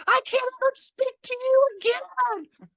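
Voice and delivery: very high, drawn-out mock wail; mortified wail. Chiropractor imitating the fleeing patient, mortified high wail. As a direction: squeal it slowly and loudly in mock distress.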